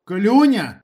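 high, loud angry call; agitated yell. A narrator's reading. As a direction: shout the name, wound up with anger. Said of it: "голос Ніколаєча задержав від хвилювання і гніву".

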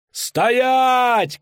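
high, loud commanding shout. Acted. Rider bursting into the square, loud high shout. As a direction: halt the crowd with a cry. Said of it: "крикнул наездник".

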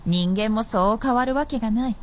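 usual speaking voice

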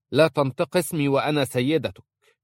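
high, loud indignant rebuff; haughty anger. A narrator's reading. Mistress rebuking Aisha for using her name, angrily. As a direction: put an inferior in their place sharply.

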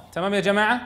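ordinary delivery

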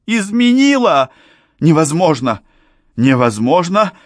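high, loud indignant shout; furious outcry. A narrator's reading. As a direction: hurl the denial at him. Young man's outburst.

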